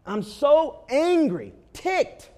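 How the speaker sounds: loud, slow pretend rage with a high squeak on ticked; mock angry outburst. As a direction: play the stranded driver fuming at the car. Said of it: "you got out and you said".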